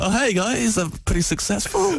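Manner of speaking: pretending to be a successful homeowner; cheesy faux-confident greeting; mock confident.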